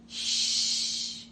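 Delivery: long soft "श" hiss with no voice; hissing sh sound. Shown as a demonstration. Teacher demonstrating the hissing sound for the class. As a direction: hiss out "श" like air escaping. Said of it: "ये आवाज आती है".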